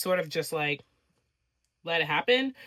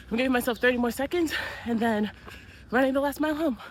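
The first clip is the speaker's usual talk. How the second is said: pushing words out while panting; out of breath; 8 semitones higher, faster than usual. Real, not acted. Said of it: "I'm out of breath".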